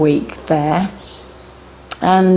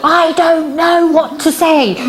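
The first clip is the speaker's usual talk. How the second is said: high, drawn-out exasperated protest; hammed-up flustered cry, pitched way up; mock panic; 10 semitones higher, slower than usual. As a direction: wail it helplessly, as a comic retelling. Acting out flustered reply on phone.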